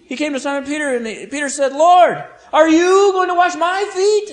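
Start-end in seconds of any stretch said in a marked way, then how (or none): 1.7-4.3 incredulous high cry, punching "you" and "my"; emphatic pronouns. Acted. Peter objects to Jesus washing his feet, emphatic pronouns. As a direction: shout it high, hitting "you" and "my". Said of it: "And the pronouns are emphatic".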